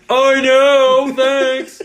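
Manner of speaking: mock-smug high squeal; a loud, high, smug thank-you; sarcastic retort. To friends who insulted him, mock-smug reply. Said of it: "I was like".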